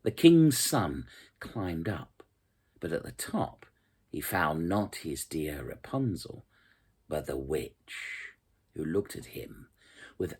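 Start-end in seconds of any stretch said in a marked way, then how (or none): none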